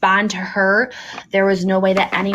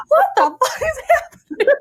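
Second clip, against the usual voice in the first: squeaky high through laughter; baffled outburst cracking with laughs; high, laughing what-the-hell; laughing disbelief. Retold or imitated. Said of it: "Ajax is like".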